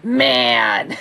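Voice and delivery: breathy, loud mock groan of dismay. Recalling her pile, a mock groan of dismay. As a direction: moan it like a sore loser. Bemoaning losing the boxes.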